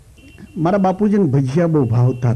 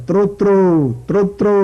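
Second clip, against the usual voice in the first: raised call to the others, a bit higher; 5 semitones higher, faster than usual. Retold or imitated. Stuck rider calls to the passing horsemen, raised voice.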